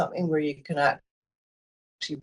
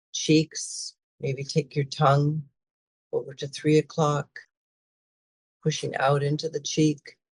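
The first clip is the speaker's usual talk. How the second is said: calm, measured meditation guide, with long pauses between phrases; guided meditation. Real, not acted. Teacher guiding listeners to watch their thoughts and breath, calm meditation voice. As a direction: ease them into noticing breath and body, unhurried.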